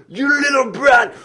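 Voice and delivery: very high, loud, slow screamed insult; furious yell. Retold or imitated. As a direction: scream it like a boss losing it. Furious boss yells at him.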